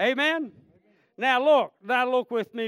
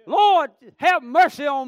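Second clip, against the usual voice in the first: loud pleading cry, higher and louder; 4 semitones higher, 8 dB louder than usual. Acted. Blind beggar calling to Jesus past the crowd, loud cry. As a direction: beg at the top of your voice. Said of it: "Cried out more".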